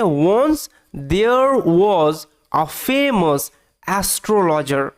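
very slow, separated words; word-by-word reading. Shown as a demonstration. Teacher reading the story's first line to pupils, word by word. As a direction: read it out one word at a time. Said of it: "আমি একদম ভেঙে ভেঙে পড়ানোর চেষ্টা করবো".

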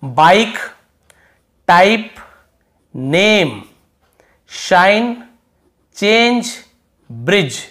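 each word called out loud and separate; model word list. Shown as a demonstration. Teacher reading the word list aloud to students. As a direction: announce each word crisply with pauses. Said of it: "तो देखिए".

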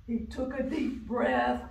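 usual speaking voice

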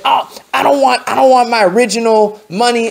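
a hint of a fussy customer. Customer voiced in a story.

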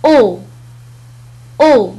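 teacher modelling "أو"; French word demo. Shown as a demonstration. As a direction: model "أو" twice for students. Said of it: "مثال آخر".

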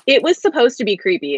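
normal talking voice

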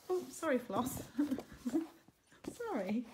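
ordinary delivery

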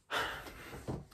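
long unvoiced exhale; defeated sigh after a long night; heavy sigh. Real, not acted. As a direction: let out a weary breath. Sigh after despair story.

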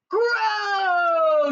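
high, loud, drawn-out bellow; shouting. A narrator's reading. Impatient giant ordering the vegetables, shouting. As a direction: bellow it as one long command. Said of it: "shouted the giant".

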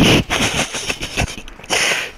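long voiceless wheezing laugh. Real, not acted. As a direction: laugh out mostly on breath. Sweaty after tense play.

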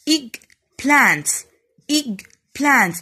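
"egg plants" as two separate loud beats; teacher sounding out "egg plants" beat by beat; split into two syllables. Shown as a demonstration. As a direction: pause firmly between "egg" and "plants". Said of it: "بقطع الكلمة وأنا بنطقها على مرتين".

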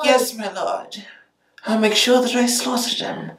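high fawning servant voice; high vampire servant voice. Shown as a demonstration. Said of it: "vampire voice".

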